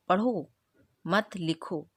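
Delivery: पढ़ो, a clear comma pause, मत लिखो; pause after पढ़ो. Shown as a demonstration. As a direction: break after पढ़ो, keep मत लिखो together. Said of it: "अल्प विराम लगा है".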